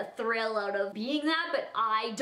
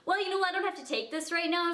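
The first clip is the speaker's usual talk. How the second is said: defensive snap; 6 semitones higher, faster than usual. Retold or imitated. Cheater snaps back, hangs up.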